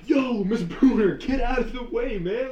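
high, loud warning yell; retold yell. Boy on sled yelling at the teacher, loud warning. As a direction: holler at her to move. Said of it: "screaming at her and everything".

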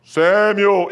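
drawn-out, resonant summons; voice of God calling. Acted. God calls Samuel a second time.

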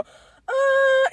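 nasal "uh" with the nose held; pinching nose. Shown as a demonstration. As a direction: hold the nose and sing "uh". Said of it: "Hold your nose like this and go".

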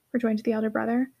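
plain reading voice

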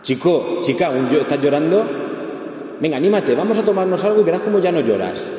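mock the friend who says stop crying, let's have a drink; cheerful consoler. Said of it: "alguien que te va a decir".

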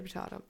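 "potato" with a broad AH, murmured as the punchline; I say potahto. Shown as a demonstration. Answering co-host's prediction, a soft joking po-TAH-to. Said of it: "Okay, potato".

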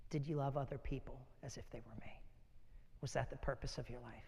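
soft whispered question from God; hushed whisper. Acted. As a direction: whisper it as God asking gently. God's final question to believers.

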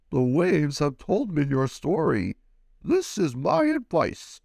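eerie wise octopus advising; wise old octopus. Acted. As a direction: speak like an ancient sea oracle. Octopus gives advice.

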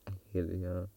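soft, low muttered complaint; quiet grumble. Real, not acted. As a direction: say it under your breath, fed up. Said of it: "I hate it here, guys".

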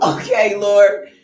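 half-laughing, loud complaint to God; exasperated to God. Retold or imitated. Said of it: "And I'm like".

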